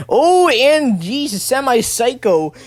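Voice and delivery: high, loud, drawn-out reaction; excited outburst. Real, not acted. Commentator reacting to Semi Psycho's sudden move, excited. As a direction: stretch the cry, then slowly land the name.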